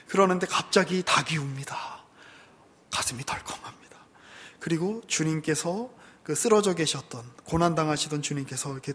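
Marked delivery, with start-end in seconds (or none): 2.9-4.0 breathy dramatic whisper; whispered storytelling. A narrator's reading. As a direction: hush them at the moment of shock. Dramatic hush at rooster crow.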